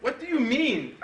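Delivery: ordinary delivery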